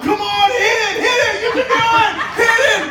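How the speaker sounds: high, loud stadium cheer for the batter; retold cheering yell. Son cheering the Cardinals at the game, loud high yell. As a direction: yell it like a kid cheering at the ballpark. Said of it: "He yelled out".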